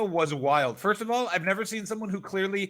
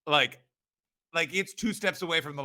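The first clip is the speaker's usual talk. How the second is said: ordinary delivery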